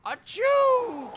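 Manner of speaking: big high sneeze; loud sneeze. Acted. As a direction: let out a big cartoon sneeze. Can't stop sneezing.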